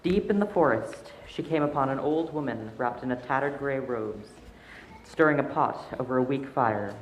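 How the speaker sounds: calm storytelling voice; story narration. A narrator's reading. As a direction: read it like a bedtime tale. Story narration read aloud.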